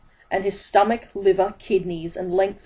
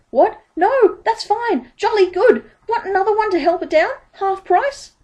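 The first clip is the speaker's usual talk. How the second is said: very high, loud, giddy relief; giddy relief, pitched very high; relieved Dibbler; 14 semitones higher, 6 dB louder than usual. A narrator's reading. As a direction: gush like a relieved huckster.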